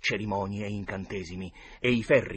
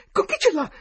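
very high, loud, panicked question; terrified gasp; 14 dB louder than usual. A narrator's reading. Huck hears the dog howl beside them, gasping in fear. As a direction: gasp it out in terror. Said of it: "boccheggiò Huckleberry".

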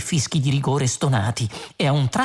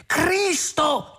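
high, horrified cry; exclaiming; 14 semitones higher than usual. A narrator's reading. Arthur struck by a terrible thought, exclaiming. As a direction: burst out at high pitch. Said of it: "esclamò".

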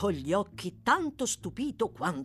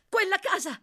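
high, loud cry of alarm; cried out; 14 semitones higher, 5 dB louder than usual. A narrator's reading. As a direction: urgently point it out. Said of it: "gridò Oliver". (grido acuto di sgomento)

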